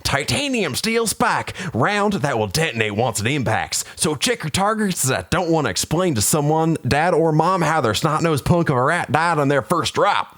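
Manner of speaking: high, gruff sergeant lecture; drill sergeant bark. Acted. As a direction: bark the warning at recruits.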